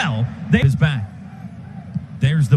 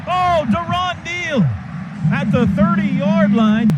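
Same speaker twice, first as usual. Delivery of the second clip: excited call; 10 semitones higher, slower than usual. Real, not acted. Radio announcer calling a reversed catch, excited shout. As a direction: shout it out as the play flips in front of you. Live call of a dropped pass.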